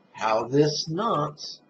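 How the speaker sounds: measured, emphatic meditation cue; contemplative prompt. Shown as a demonstration. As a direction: stretch each word over one long in-breath. Models phrase for in-breath contemplation.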